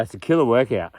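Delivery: normal talking voice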